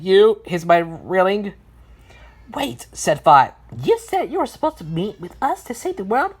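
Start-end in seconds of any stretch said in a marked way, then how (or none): 2.5-6.3 high character voice, rising query; questioning number character. Acted. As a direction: ask it high-pitched, lifting at the end. Five questions the letters.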